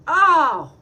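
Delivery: jolted by a thought; startled oh. Real, not acted. Reacting to her friend Dex, loud surprised oh.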